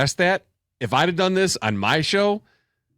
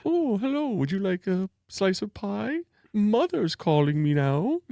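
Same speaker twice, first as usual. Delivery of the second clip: softer, slow, creepy mock-sweet voice; Ed Gein voice; 6 semitones higher, 3 dB quieter than usual. Imitating Ed Gein greeting his dead mother, creepy soft. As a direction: croon it like a creepy gentle killer. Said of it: "He's like".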